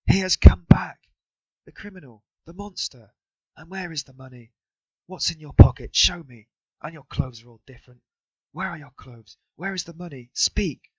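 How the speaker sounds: high, shrill, furious tirade; wife screams at her drunk husband; frenzied scolding. A narrator's reading. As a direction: berate him in a frenzy. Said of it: "she cried out in a frenzy".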